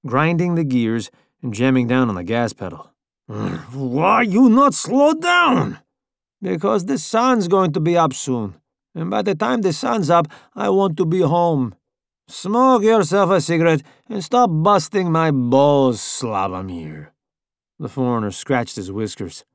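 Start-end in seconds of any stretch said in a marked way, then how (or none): 3.3-5.8 put-on high, very slow pleading accent; Slavic accent. A narrator's reading. 6.4-17.1 higher, brusque driver voice; Italian driver voice. A narrator's reading.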